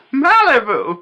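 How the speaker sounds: loud, high cry of disbelief; incredulous exclamation. Real, not acted. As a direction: blurt it out in disbelief. Incredulous repeat of his hometown.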